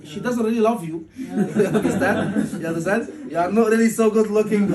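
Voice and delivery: laughing through the tease. Real, not acted. Teacher teasing the student about "love", laughing. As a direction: chuckle the words out, amused.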